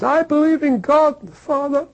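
high-pitched mock-tearful cry; tearful declaration. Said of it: "through his tears".

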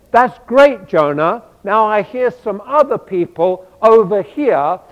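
a hint of God, upbeat and pressing; God cheerfully nudging Jonah toward other oppressed people; voicing God. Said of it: "And then God says to him".

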